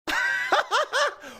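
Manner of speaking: high burst of laughter; excited laugh. Real, not acted. Laughing after knockdown.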